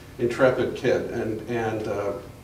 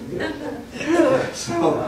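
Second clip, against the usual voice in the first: laughing; much slower than usual. Real, not acted. Laughing at wild festival story.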